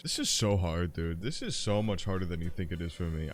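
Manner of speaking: ordinary talk, nothing marked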